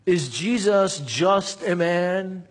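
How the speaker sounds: slow rhetorical question. Acted.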